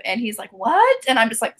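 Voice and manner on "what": higher-pitched, startled imitation of a husband; woken husband. Husband woken by his wife's laughing, startled. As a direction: mimic being jolted out of sleep, pitch up. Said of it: "I've woken my husband up".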